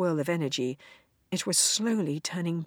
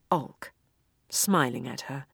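plain reading voice

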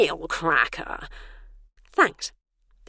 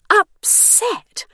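much higher disbelieving upset; incredulous echo; 7 dB louder than usual. A narrator's reading. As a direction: scoff at the word Upset?. Bernice echoes the word incredulously.

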